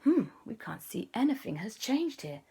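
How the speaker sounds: lightly voiced baffled officer; puzzled police. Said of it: "they were like".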